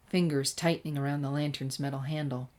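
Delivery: ordinary delivery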